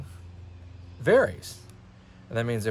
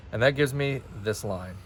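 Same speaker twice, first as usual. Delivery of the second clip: normal talking voice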